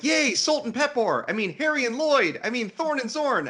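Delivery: very high, gleeful list of exclamations; hammed-up squealing celebration; mock cheering. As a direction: cheer each pair like a thrilled fan. Mock cheering the reunited pairs.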